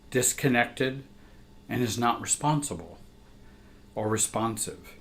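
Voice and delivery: ordinary delivery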